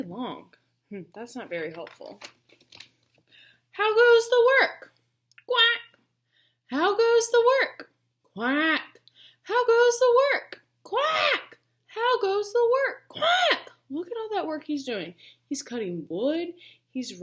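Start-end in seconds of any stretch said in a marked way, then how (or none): 3.7-4.9 loud, high farmer's call. Acted. Farmer calling, children answer. 5.5-13.7 duck quack game. Acted.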